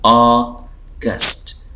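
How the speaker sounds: August very slowly as AW, gust; syllable by syllable. Shown as a demonstration. Teacher showing two syllables, August slowly split. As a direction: draw out August in two separate parts. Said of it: "दो syllable हैं".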